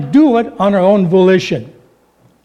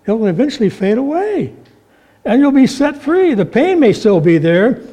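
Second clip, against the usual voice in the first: ordinary talk, nothing marked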